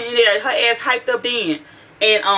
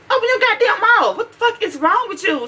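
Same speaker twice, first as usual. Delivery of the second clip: much higher, exasperated demand; exasperated outburst; 12 semitones higher than usual. Retold or imitated. Parent telling whispering daughter to open her mouth, exasperated. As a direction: let the pitch leap up in frustration.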